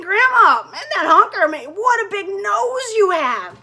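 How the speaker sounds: loud, high gawking at the honker; astonished. Acted. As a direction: exclaim it, amazed and loud. Red gawks at granny's nose.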